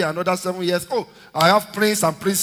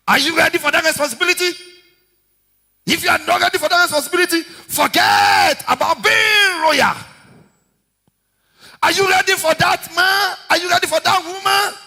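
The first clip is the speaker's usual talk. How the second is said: high, loud, fast pulpit questions; challenging preacher shout; preaching challenge; 13 semitones higher, 5 dB louder than usual. Acted. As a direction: fire the questions at the congregation.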